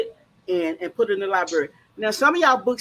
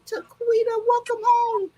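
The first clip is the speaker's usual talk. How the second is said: much higher, sing-song welcome home; excited welcome; 14 semitones higher than usual. Real, not acted. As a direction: call her in with delight. Welcoming guest back home.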